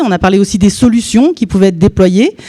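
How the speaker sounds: normal talking voice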